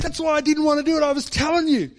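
high, loud, near-crying complaint at God; livid protest to God. Retold or imitated. Preacher retelling his furious complaint to God, high and loud. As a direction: let the frustration break out high and loud. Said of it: "I was livid".

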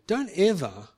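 ordinary talk

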